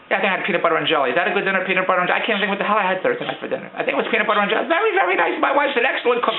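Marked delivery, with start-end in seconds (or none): 4.7-5.4 sarcastic. Real, not acted.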